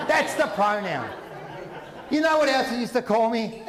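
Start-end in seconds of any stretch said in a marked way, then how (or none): none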